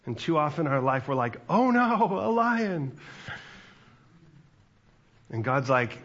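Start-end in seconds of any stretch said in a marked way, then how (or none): 1.5-3.0 high, loud cry of alarm; hammed-up panic, pitched way up; mock panic. Acted panicked reaction to a lion.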